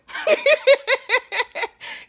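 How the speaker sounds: loud high cackle; laughing at her own alter ego act; high burst of laughter. Real, not acted.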